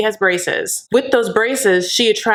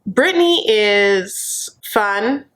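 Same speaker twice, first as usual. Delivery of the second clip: normal talking voice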